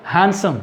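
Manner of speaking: हैंडसम said as hansom, the d dropped; exact pronunciation. Shown as a demonstration. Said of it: "exact pronunciation क्या होगा?".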